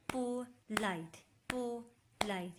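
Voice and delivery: पोलाइट as "पो... लाइट", with a clear gap; slow two-part पोलाइट, falling on "लाइट"; breath broken between syllables. Shown as a demonstration. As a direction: tap out पोलाइट in two separate beats. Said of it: "सांस टूट रही है".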